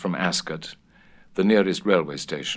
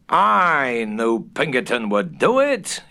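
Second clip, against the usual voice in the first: louder, higher, slow gloating; triumphant exclamation; 5 semitones higher, 4 dB louder, slower than usual. A narrator's reading. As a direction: crow over the victory. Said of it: "he exclaimed triumphantly".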